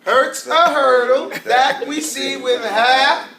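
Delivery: slow, measured rhyme recital; drawn-out poem for pupils to join; teacher's mnemonic poem. Shown as a demonstration. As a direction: recite slowly, leaving the last word to the class. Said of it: "You know I made a poem".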